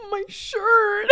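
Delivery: high gasp then a wailed my shirt; gasp, then whimper over the shirt; mock gasp and wail. Sister mourning her ripped shirt, high mock wail. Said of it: "and she's like".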